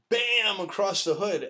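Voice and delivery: punchy high bam; loud bam. Retold or imitated. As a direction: hit the word like a blow. Mimicking the nunchuck hit.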